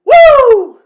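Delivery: huge, high belted "Woo!"; Ric Flair whoop. Pretend. Streamer paying ode to a wrestler, belted high whoop. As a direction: belt out a long, piercing wrestler's whoop. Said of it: "if I could belt that out".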